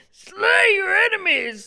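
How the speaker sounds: high, loud, drawn-out battle cry; hyped shout. Pretend. Guest cheering the trivia win, hyped shout. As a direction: shout it high and long, stretching the first word.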